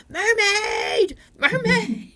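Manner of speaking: high, drawn-out mock holler; playful yell. Showing how to yell at the water for mermaids, playful high holler. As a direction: call out high, as if across the water. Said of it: "stand at the water and yell".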